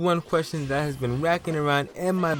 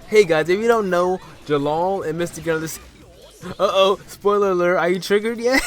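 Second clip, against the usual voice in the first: loud teasing; 3 semitones higher, 6 dB louder than usual. Real, not acted. Spoiler alert teasing viewers.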